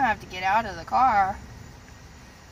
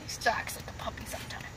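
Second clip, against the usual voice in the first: whispering; 9 semitones lower, 13 dB quieter than usual. Real, not acted. Whispering to the dog.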